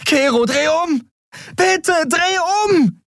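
very high, pleading yell; frantic high-pitched shouted plea; desperate scream. A narrator's reading. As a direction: scream and beg to turn back. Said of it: "schrie sie".

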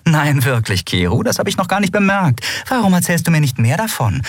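sarcastic retort. Acted. Endriel spottet über Keru.

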